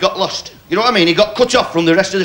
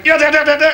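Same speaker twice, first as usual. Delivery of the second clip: machine-gun noise; 10 semitones higher than usual. Retold or imitated. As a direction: rattle off the guns with gusto. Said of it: "but he went".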